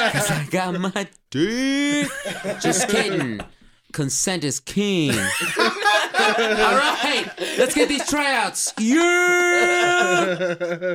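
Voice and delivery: rally the recruits like a crude team captain; Zach Smith. Acted. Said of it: "Zach Smith".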